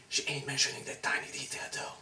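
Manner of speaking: fast conspiratorial whisper aside. Acted. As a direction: lean in and whisper it quickly. Snide aside to audience.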